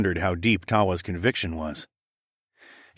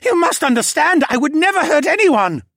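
begging creature voice; 9 dB louder than usual. Acted. Cadahy still begging.

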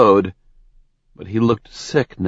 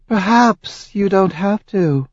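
sly; 10 semitones higher than usual. Acted. Azura sly hint.

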